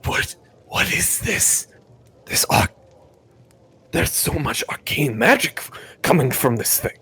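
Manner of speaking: soft, half-whispered character voice; hushed, bewildered question; Alabaster Cinder. Acted. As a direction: ask it under your breath, baffled.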